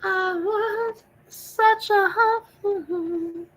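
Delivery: high, very slow eerie wail; spooky ghost voice. Pretend. Streamer spooking chat with a slow ghostly wail. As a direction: drag each word out like a haunting ghost. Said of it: "now I'm being silly".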